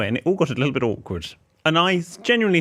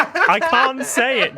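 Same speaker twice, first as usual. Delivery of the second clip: high, slow, laughing protest; 12 semitones higher, 4 dB louder, much slower than usual. Real, not acted. Embarrassed co-host refusing to read message, laughing. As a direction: squeeze it out through laughter.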